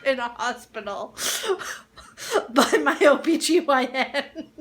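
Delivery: breaking up with laughter while talking; laughing. Real, not acted. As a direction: struggle to get the punchline out through laughter. Cracking up at own story.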